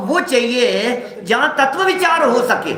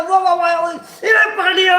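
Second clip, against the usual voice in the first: much higher, shouted wah wah with a laugh; excited cheering; 8 semitones higher than usual. Retold or imitated. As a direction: whoop like a fielder who just caught it. Imitating catcher's joyful catch.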